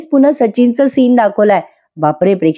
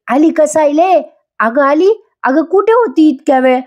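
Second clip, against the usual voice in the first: gushing welcome; 7 semitones higher than usual. Retold or imitated. Maina gushing at Sayali as she walks in, high and syrupy. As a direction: gush at her like a doting aunt.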